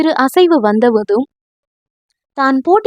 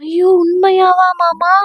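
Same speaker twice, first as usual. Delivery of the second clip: shocked gasp; 5 semitones higher than usual. Acted. She is shocked.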